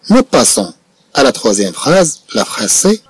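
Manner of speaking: ordinary talk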